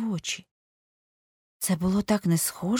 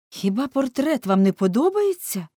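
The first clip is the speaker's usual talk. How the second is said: higher, louder startled question; exclaiming; 7 semitones higher, 6 dB louder than usual. A narrator's reading. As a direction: cry out the question in dismay. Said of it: "вигукнув нарешті Голворд". (вигук, голосно й високо)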